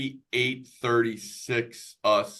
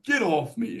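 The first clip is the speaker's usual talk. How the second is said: higher, half-hearted replay of the angry shout; remembered snap; 10 semitones higher than usual. Retold or imitated. Dad recalling what he yelled at his wife, a higher, half-hearted snap. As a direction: toss it off as a rueful echo. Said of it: "I still remember".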